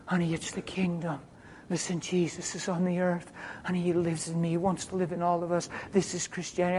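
soft, gentle explaining; quiet, intimate and sincere; tender reassurance. Retold or imitated. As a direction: speak softly to someone you are comforting. Retells tender words to healed woman.

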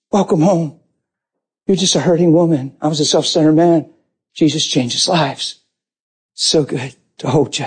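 low, gentle reassurance; tender comfort. Retold or imitated. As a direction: soothe her, letting the voice sink. Husband comforts wife in retelling.

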